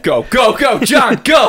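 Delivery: loud, high cheering; cheering shout. Pretend. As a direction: yell them on like a fan. Cheering on the wolf.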